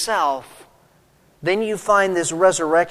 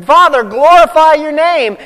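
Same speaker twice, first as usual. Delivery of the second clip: higher and louder, ringing out; fervent cry; 9 semitones higher, 8 dB louder than usual. Retold or imitated. Preacher acting out Jesus crying to his Father, loud. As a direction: cry it out to heaven with full fervour.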